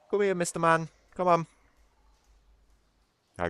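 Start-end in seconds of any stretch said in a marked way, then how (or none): 0.1-1.5 high coaxing pet call; calling the cat. Real, not acted. Streamer calling his cat to the camera in a high coaxing voice. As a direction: coax him over, high and sweet.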